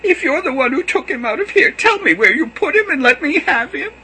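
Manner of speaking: high, loud pleading cry; pleading wail. Acted. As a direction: cry out to him, desperate. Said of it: "she cried".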